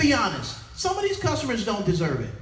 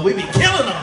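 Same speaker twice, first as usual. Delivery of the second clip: boasting; 8 dB louder than usual. Real, not acted.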